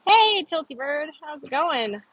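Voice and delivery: ordinary delivery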